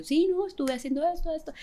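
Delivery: light high-pitched carefree answer; high breezy reply. Retold or imitated. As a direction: play it innocent and chirpy. Casual reply to border agent.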